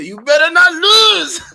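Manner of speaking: high, loud, slow bellow; hammed-up screamed threat; mock furious shout. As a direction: yell it like a slap. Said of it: "She said".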